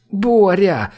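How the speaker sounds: pleading. A narrator's reading. Olga calls to Boris a second time, pleading. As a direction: cry out to him, begging. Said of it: "повторила Ольга".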